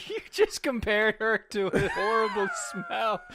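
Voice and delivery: loud laughing disbelief. Real, not acted. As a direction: laugh through the words. Laughing at the comparison.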